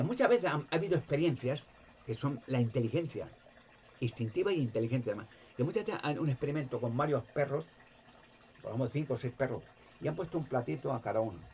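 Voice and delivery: ordinary delivery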